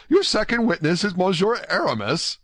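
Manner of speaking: very high, incredulous question; amazed young man, pitch leaping up; greater astonishment. A narrator's reading. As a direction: ask as if you can hardly believe it. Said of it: "an accent of greater astonishment than before".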